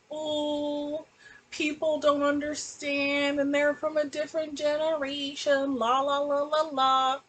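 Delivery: high mocking sing-song of dismissive parents. Mocking parents who won't listen, high sing-song.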